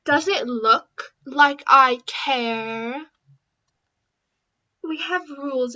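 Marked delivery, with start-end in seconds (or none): 0.0-3.0 loud, slow sneering dismissal; mean girl scoffing at a rival; dismissive. Acted. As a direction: draw it out with contempt.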